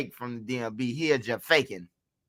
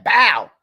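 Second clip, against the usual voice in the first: loud mock punch sound, higher and louder; 6 semitones higher, 10 dB louder than usual. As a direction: bark out a cartoon punch impact. Punch sound after Zoo punches.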